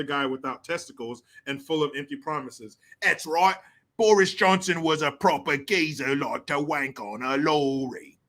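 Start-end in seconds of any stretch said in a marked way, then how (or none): 3.0-8.2 put-on Cockney geezer accent; joking London Cockney accent; mock British accent. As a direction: ham up a Cockney accent for laughs. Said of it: "making fun of these damn accents".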